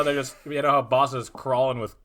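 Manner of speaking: slow hammed-up Boston accent. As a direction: drawl like a Bostonian. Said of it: "That's your Boston accent".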